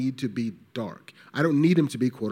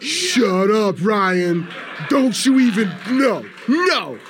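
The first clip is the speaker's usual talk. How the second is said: higher and louder, giddy protest; gush, embarrassed and thrilled; high, loud mock-flustered denial; flustered fan squeal; 8 semitones higher, 6 dB louder, much slower than usual. Flustered reply to Ryan Coogler.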